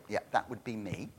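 low, quiet, deadpan aside as God; quiet sarcastic God voice; God's dry aside. Acted. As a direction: mutter it as God, unimpressed. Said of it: "imagine God going".

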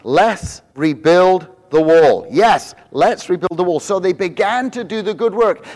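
high, loud, rousing sermon delivery; rally the congregation with rising fervor; full-force preaching. Acted.

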